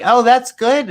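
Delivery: chirpy salesman. Retold or imitated. Selling the god like a sausage, chirpy and high. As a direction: sell it like a cheerful huckster.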